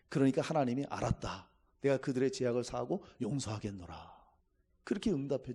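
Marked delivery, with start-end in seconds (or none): 0.9-4.1 gentle God. Retold or imitated. God forgives the people.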